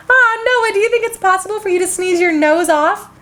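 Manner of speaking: ordinary delivery, nothing marked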